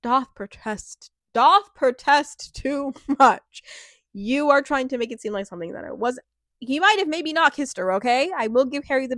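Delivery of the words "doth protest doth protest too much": slow, grand Shakespearean delivery laughing through it; theatrical quote. Pretend. Mocking Harry's denials with slow theatrical grandeur. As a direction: ham up the old line, slowly. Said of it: "summed up into a couple words".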